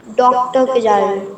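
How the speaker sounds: a careful trial of डॉक्टर with the open "aw" vowel; trying it aloud. Shown as a demonstration. Student tries the sentence aloud when the teacher asks, careful. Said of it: "बोल के देखिए".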